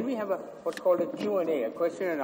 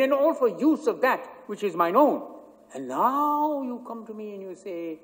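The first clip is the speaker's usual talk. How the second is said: bitter, proud moneylender voice; Shylock; 4 dB louder than usual. Acted. Shylock's speech performed on stage.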